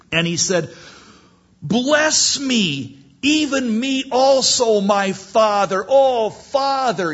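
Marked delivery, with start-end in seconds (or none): 1.6-7.1 high, loud, long-drawn acted wail; bitter wailing cry. Esau begging his father for a blessing, bitter wail. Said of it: "he's crying with a bitter cry".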